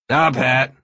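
gruff voice. A narrator's reading. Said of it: "a gruff voice said".

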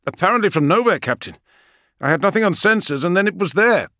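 high, loud pilot voice; a crisp pilot calling in from the cockpit; gunship pilot. Acted. Pilot reports to captain.

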